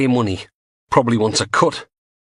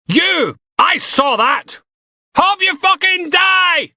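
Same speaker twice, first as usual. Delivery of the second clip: high, slow, furious shouting; an enraged protester yelling at the prisoner; furious insults; 5 dB louder, slower than usual. Acted. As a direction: scream abuse at him.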